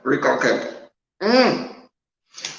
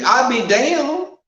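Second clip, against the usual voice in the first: slow, louder, pitched-up incredulous retort; mock-appalled, raised, slowed reply; indignant disbelief; 8 semitones higher, 3 dB louder, slower than usual. Real, not acted. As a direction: drag out the outrage at the idea. Indignant reaction to the quote.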